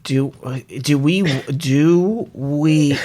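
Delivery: very slow, halting words; too slow. Real, not acted. Host dragging out the wrap-up for waiting friend, too slow. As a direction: stretch each word far out. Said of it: "you're talking too slow".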